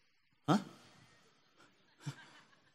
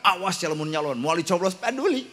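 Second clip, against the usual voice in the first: a mock threat from a mother, higher and a little louder; playfully voicing a mother's warning; threatening mother; 5 semitones higher, 4 dB louder than usual. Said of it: "Sok ibu rek ngancam". (ngancam siga indung, rada luhur)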